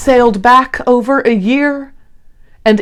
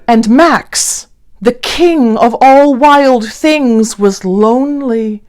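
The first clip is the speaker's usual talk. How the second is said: usual speaking voice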